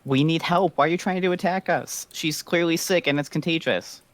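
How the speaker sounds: distressed yelling. Acted. Panicked villager to an armed soldier, distressed high yelling. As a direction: plead in a high, agitated voice. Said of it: "yell and babble more".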